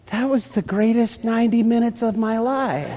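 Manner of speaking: high, soft, dreamy quote; wife dreamily recalling a massage, blissful; blissful sigh. Retold or imitated. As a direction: melt into the memory of bliss.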